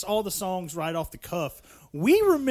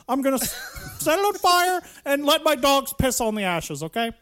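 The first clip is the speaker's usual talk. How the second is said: higher and louder hammed-up outrage, laughing through it; over-the-top pretend tirade breaking into laughs; mock rant; 8 semitones higher, 4 dB louder than usual. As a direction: fake fury at the giggling cohosts.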